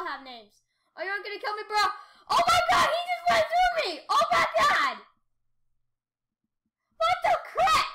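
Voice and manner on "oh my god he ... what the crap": high, loud disbelieving shouts; real outraged shriek; shocked yelling. Real, not acted. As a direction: yell at the snake that cut you off. Run over by another snake.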